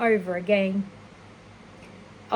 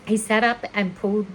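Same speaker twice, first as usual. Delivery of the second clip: plain reading voice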